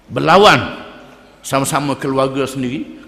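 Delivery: normal talking voice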